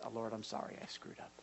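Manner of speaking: very low, hushed apology; humble confession. Acted. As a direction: confess it under your breath. Models a humble prayer.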